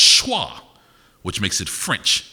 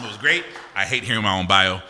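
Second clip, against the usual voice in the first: normal talking voice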